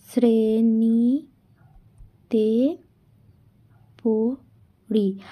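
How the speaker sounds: slow, soft, each syllable stretched apart. Shown as a demonstration. Teacher splitting the sentence into syllables for the class, slow and soft. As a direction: break আমি অষ্টম শ্রেণীতে পড়ি into drawn-out syllables. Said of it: "তাহলে এটাকে আমরা করে দেখি".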